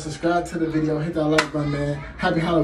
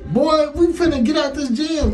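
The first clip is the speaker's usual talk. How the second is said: high-pitched panicky yelp, much higher and louder; panicked; 11 semitones higher, 4 dB louder than usual. Retold or imitated. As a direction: squeal it like you're about to bolt in terror. Panicked flight from the stalker.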